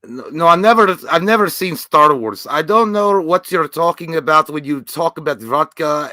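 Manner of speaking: loud, slow put-on Russian accent; mock Russian accent. Podcast host hamming up a Russian movie character. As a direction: play a gruff Russian, lightly. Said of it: "to get in character".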